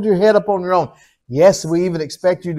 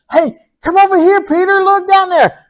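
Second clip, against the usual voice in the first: excited calling out; 11 semitones higher, 4 dB louder than usual. Acted. God calling Peter over.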